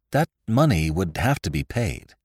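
usual speaking voice

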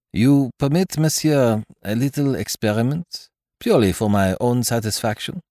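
a hint of a polite French detective; lightly voiced courteous Belgian; Poirot's voice. Poirot voice held.

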